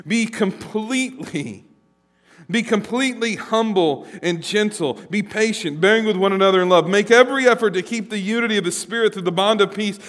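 rising, fervent reading of scripture; preacher reading Paul's letter breathlessly to the congregation. Acted. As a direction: build the list to a ringing peak. Reads Ephesians aloud.

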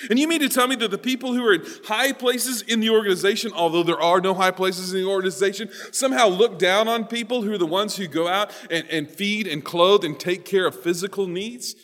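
high, loud, disbelieving challenge; incredulous challenge. Acted. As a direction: argue it as plainly absurd. Voices Paul's indignant incredulous challenge.

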